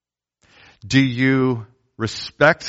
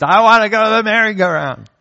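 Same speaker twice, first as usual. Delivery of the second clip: put-on much higher, louder child's wail; a child refusing to leave the playground; toddler wail; 10 semitones higher, 5 dB louder than usual. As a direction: wail it like a toddler lying on the ground. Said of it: "she's just".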